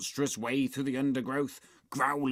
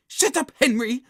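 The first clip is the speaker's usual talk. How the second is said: put-on high, loud bossy child; cross telling-off; 10 dB louder than usual. A narrator's reading. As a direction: snap at a noisy friend. Margaret silences Henry in a quarrel.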